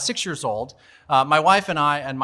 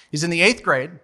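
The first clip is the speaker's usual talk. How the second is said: ordinary delivery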